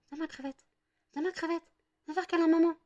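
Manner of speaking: sing-song call for a cuddle; mother coaxing her puppy, in a high coo. Real, not acted.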